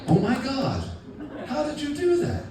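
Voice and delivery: mock-amazed girlfriend. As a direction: gush like he did a miracle.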